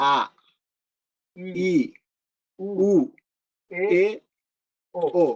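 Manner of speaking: "আ, ই, উ, এ, ও" as separate pure vowels; Japanese vowel demonstration. Shown as a demonstration. Teacher has students listen closely, "আ, ই, উ, এ, ও" spaced out as pure vowels. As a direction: sound out "আ, ই, উ, এ, ও" one by one, pausing between. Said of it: "ভালো করে শোনো".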